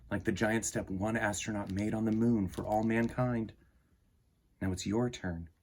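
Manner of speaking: soft, measured storybook reading; reading to children. Acted. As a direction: read it gently to a young audience, letting lines land. Reads picture book aloud.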